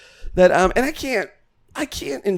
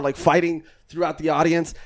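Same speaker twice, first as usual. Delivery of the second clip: normal talking voice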